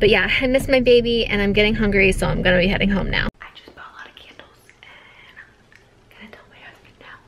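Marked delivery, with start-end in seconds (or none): 3.4-7.2 whispering. Real, not acted. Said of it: "the baby's sleeping".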